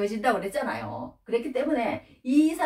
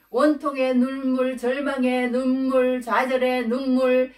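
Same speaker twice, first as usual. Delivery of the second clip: slow, high, louder preacher's cadence; preaching emphasis; 4 semitones higher, 4 dB louder, slower than usual. Acted. Pastor to congregation about Esau's tears, slow emphatic preaching. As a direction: lay out each kind of tear slowly, like a sermon peak.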